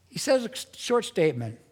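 normal talking voice

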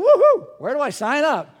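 loud, high whoop of eager joy; a sick man hearing of a cure, whooping loud; eager cheer. Pretend. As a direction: cheer like someone saved from the disease. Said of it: "you'd be going".

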